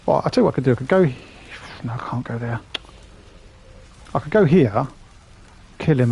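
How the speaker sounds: normal talking voice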